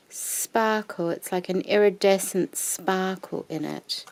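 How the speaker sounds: ordinary talk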